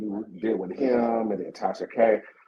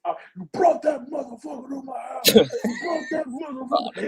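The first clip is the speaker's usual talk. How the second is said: furious shout; 12 semitones higher than usual. Retold or imitated. Retold guest screaming at the host in fury. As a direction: yell it like he just stormed in. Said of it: "when he goes".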